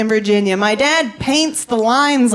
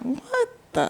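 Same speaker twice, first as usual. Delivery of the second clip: shocked dad; 12 semitones higher, 10 dB quieter than usual. Retold or imitated. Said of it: "He was like".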